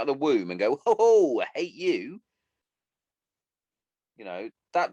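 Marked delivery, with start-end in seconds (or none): 0.8-2.2 loud, high mocking whoop, easing off; exaggerated, put-on hateful cry; mock outburst of hate. Speaker mocks the idea of babies born hating, mock outburst. Mock newborn declaring hatred at birth.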